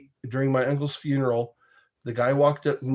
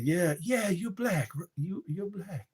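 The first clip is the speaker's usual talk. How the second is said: higher, softened mimicking voice; imitated coworkers; 5 semitones higher, 6 dB quieter than usual. Coworkers in the break room confirming, high soft imitation. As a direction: echo them in a high, soft voice.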